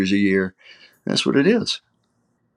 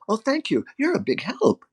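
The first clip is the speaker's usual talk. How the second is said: much higher, softer, taking it at face value; innocent sincere thanks; 11 semitones higher, 5 dB quieter than usual. Acted. Taiwanese listener taking sarcasm literally, innocent sincere thanks. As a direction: imitate naive, wholehearted gratitude. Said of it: "they will look at it and says".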